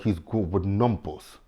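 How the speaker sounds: slow, clenched-jaw imitation of the accent; mock the accent through a clenched jaw; mocked Kanan accent. Mocking Kanan answering Crystal, in his clenched-jaw accent. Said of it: "This accent".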